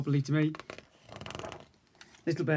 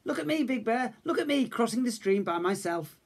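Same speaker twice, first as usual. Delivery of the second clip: higher, showing-off cub voice; proud little cub; 9 semitones higher than usual. A narrator's reading. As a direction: boast about crossing alone. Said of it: "Little Bear said".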